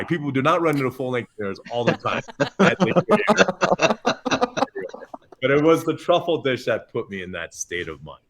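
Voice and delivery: usual speaking voice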